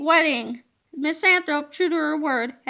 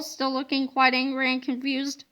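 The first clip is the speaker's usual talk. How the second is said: usual speaking voice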